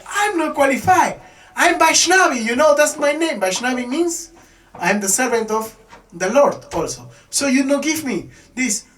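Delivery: high, loud, outraged protest; angrier. Retold or imitated. Parvati rejects Shiva's excuse, high outraged shout. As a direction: throw his words back at him, furious. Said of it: "she become more angry".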